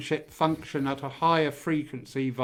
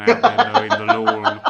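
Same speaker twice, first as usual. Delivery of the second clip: laughing; 12 semitones higher, 11 dB louder than usual. Real, not acted. Laughing at slave labor joke.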